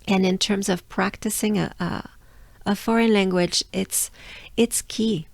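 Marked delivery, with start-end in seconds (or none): none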